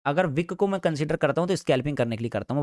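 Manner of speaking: ordinary delivery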